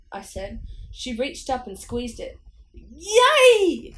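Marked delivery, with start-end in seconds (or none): 2.7-3.9 high piercing cry of pain; loud pained cry. A narrator's reading. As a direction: let out a cry heard for blocks. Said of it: "I let out a cry".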